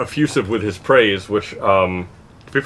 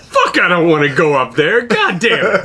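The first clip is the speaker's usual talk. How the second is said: louder, higher mock-annoyed outburst with laughter; pissed off; 5 semitones higher, 3 dB louder than usual. Friend balking at going up north, retold pissed off. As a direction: imitate a friend's indignant refusal. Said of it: "he was all pissed at me".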